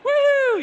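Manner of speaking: high, very loud woo; big splashy holler; cannonball whoop. Acted. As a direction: whoop like you're leaping into a pool.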